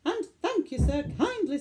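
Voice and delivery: high, sarcastic thanks through fury; sarcastic fury at the magistrate; blazing. Acted. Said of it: "mother got proper blazing".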